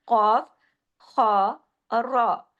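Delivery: slow, full-mouthed कॉफ, खा, रा with rounded vowels; name कॉफ, खा, रा thickly; heavy letters. Shown as a demonstration. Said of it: "मोटे हरूफ कौन कौन से". (कॉफ, खा, रा मोटी आवाज में)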